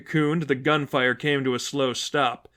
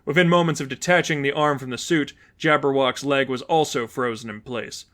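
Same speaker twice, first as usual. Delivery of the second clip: plain reading voice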